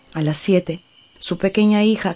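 ordinary delivery

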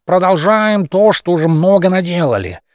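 clumsy slurred speech; mumbling. Acted. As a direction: mumble it awkwardly. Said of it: "Говорил он коряво невнятно".

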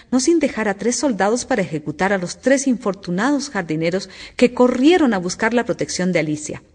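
usual speaking voice

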